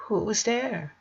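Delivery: thin, high, strained near-whisper; barely able to speak. A narrator's reading. Girl with a throat sore from crying, barely speaking. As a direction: squeeze the words out of a sore throat. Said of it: "barely managing to speak".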